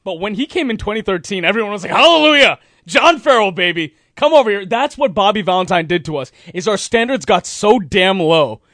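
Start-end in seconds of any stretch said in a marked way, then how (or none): 1.9-4.7 louder, higher hallelujah of relief; mock jubilant cheer. Host voicing fans greeting the new manager, mock jubilation. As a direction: welcome him like a saviour.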